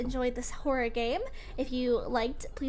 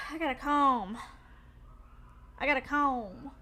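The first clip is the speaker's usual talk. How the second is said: ordinary talk, nothing marked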